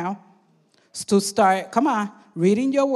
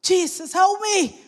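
high, raised plea for mercy; pleading cry; 7 semitones higher than usual. Retold or imitated. Peter begging Jesus for mercy, a high raised cry. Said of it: "He plead for mercy".

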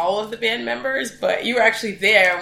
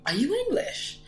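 puzzled question; 6 semitones higher, 8 dB quieter than usual. Retold or imitated. Quotes the bassist's puzzled question.

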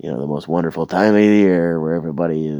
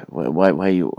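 ordinary talk

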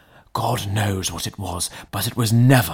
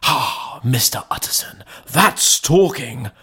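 approving cry; 6 semitones higher than usual. A narrator's reading. Butler cheering the lawyer's resolve, crying out. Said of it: "cried the butler".

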